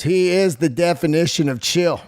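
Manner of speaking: ordinary delivery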